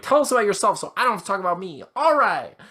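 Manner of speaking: mock cheery host. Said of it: "I'm like".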